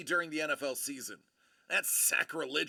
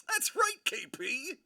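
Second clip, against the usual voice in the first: high squeaky agreement; 10 semitones higher than usual. Real, not acted. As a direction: squeal "That's right" up high.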